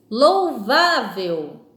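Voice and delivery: Louvável very drawn out, strong VÁ falling away; slow classroom demo of Louvável, stressed on VÁ. Shown as a demonstration. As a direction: lean long and hard on VÁ in Louvável. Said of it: "na palavra vá".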